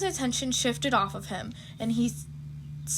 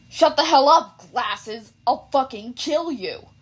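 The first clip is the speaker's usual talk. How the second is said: louder, slow furious yell; hammed-up angry shout; 6 dB louder, slower than usual. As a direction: bark it at him. Bakugo yells at Ida.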